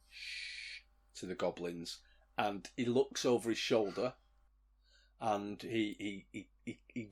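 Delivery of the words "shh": soft, drawn-out shush; hushing sound. Acted. Dweezil shushes the goblins, softly. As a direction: shush them very quietly. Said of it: "Dweezil reiterates".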